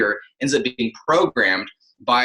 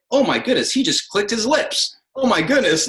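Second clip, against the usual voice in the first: higher-pitched mock outrage; mock shock; 9 semitones higher than usual. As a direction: exaggerate a scandalized listener. Voicing an imagined shocked listener.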